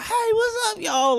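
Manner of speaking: put-on squeaky, high kid greeting falling at the end; high-pitched childhood voice. Comic mimicking his childhood greeting, high-pitched and squeaky. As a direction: imitate your squeaky younger self. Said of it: "a high-pitched voice".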